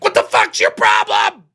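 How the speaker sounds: loud, low mock outburst at a stranger; imitated angry snap. Streamer imitating himself snapping at a shopper. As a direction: snap at someone who bumped you. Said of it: "I'd have been like".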